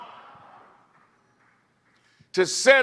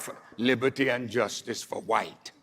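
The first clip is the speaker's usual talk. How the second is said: quiet pointed recital; 8 semitones lower, 6 dB quieter, faster than usual. Shown as a demonstration. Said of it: "I said".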